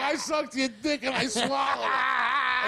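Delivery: high, slow mock yell; drunk wife yelling. Drunk wife yelling at comic on stage. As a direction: shriek it like a drunk. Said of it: "she's like".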